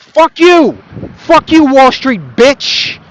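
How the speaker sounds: heated outburst. Real, not acted. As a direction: let the anger boil over. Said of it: "I'm getting a little heated here".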